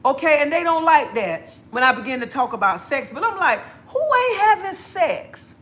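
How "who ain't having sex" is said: high, drawn-out mock disbelief; incredulous retort. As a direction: stretch the question out, pitched way up. Acts out her retort to critics.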